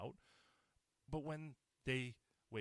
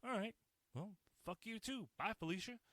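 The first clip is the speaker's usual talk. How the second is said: sarcastic dismissal; 8 semitones higher than usual. Real, not acted. Dismissing friends who cut him off.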